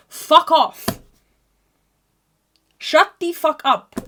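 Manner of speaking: high, loud snapped fuck off; angry outburst. Acted. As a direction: spit it out at the friend. Ranting at needy friends.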